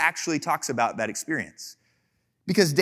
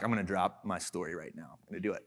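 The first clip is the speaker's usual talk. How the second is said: much lower, softer boast; smug aside; 10 semitones lower, 12 dB quieter, faster than usual. Pretend. Pastor teasing David at a party, low soft aside. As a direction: lean in and tease the story.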